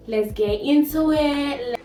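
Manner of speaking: big, drawn-out, excited call; rally the viewers in; hyped up. Real, not acted. Hyped-up sign-off to the intro.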